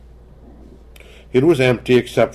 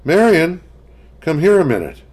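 higher, louder urgent call across the house; 8 semitones higher, 5 dB louder than usual. A narrator's reading. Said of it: "in an urgent voice".

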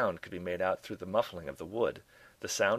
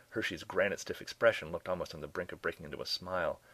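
ordinary delivery

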